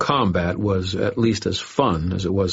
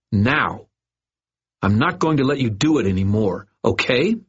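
angry confrontation; 5 semitones higher than usual. A narrator's reading. Boy scolding the bully who hurt him. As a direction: lay down the law to the bully.

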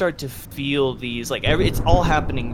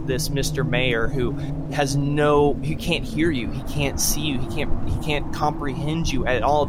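usual speaking voice

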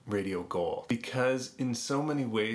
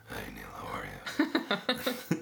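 deep radio voice impression; 13 semitones lower than usual. Retelling the colleague's hallway hello in deep voice. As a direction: greet in his too-deep hallway voice. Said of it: "he would say".

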